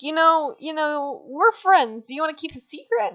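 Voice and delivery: high, chummy dog voice; Perrito. Retold or imitated. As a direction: pitch it up to win a friend. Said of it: "Perrito's like".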